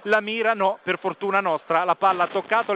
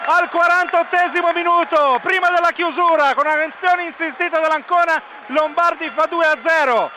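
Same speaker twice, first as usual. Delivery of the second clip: breathless italian goal commentary; 7 semitones higher, 4 dB louder than usual. Real, not acted. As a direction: scream the goal and keep it up. Second goal called live. (urlo del gol, telecronaca senza fiato)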